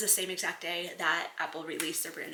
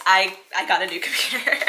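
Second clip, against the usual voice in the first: loud, elated repeat bubbling into laughter; real joy; 9 dB louder than usual. Real, not acted. Vlogger sharing the new computer news, audibly joyful. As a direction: blurt it out, brimming with joy. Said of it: "can you hear the joy in my voice?".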